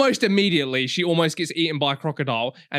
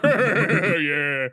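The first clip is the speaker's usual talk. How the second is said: laughing. Retold or imitated. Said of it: "Everyone's like".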